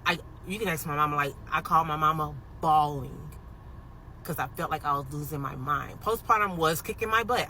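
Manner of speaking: normal talking voice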